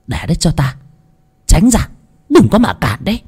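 high stern commands; commanding medium. Acted. Medium orders the family to stand aside, high and stern.

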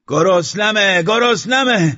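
very high, loud yell; piercing hungry cry; screaming roar. Acted. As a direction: scream it out wildly for food. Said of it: "فریاد و نعره کشیدن".

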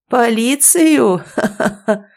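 high, slow scornful laugh; mocking guffaw. A narrator's reading. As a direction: scoff then cackle at him. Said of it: "захохотал Валера".

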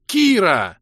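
high, loud enraged scream; furious yell. Acted. Ros screams Kira's name in a rage. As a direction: bellow her name in fury. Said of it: "я в бешенстве заорал".

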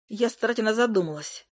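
plain reading voice

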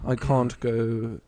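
British accent gone slightly wrong; attempted British accent. Shown as a demonstration. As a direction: try British, slipping toward Australian. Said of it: "try to do a British accent".

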